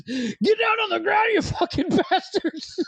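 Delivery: high, drawn-out cop yell; police-style shout. Acted. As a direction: yell the punchline like a cop making an arrest. Johnny's pig as cop in joke.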